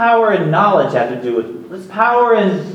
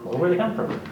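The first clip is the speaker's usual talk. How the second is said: ordinary talk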